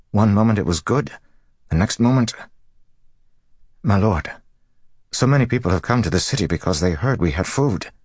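high, urgent, worried voice; distressed dockmaster. A narrator's reading. Dockmaster begging his lord for help, high and distressed. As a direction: plead helplessly for an answer.

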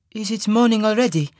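put-on high-pitched question in a lilting Tarbanese accent. A narrator's reading. Waking woman asks her lover, lilting Tarbanese accent. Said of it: "in her lilting Tarbanese accent".

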